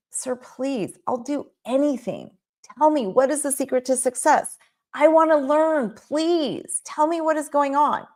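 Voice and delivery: high, pleading beggar's voice; desperate student begging the master to teach him. Retold or imitated. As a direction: beg the master, pitch rising. Man begging Aristotle.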